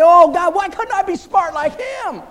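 high, loud, drawn-out pleading; mock plea to God. Preacher mock-pleading with God to be smart, high. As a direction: beg heaven in comic despair. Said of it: "I say".

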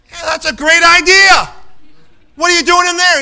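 stranded businessman hollering sarcastically at the asylum inmate; sarcastic businessman. Retold or imitated. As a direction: play the fed-up driver yelling through the gate. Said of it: "And the guy said".

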